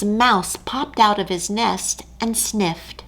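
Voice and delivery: usual speaking voice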